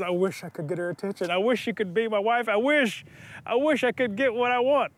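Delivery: ordinary delivery, nothing marked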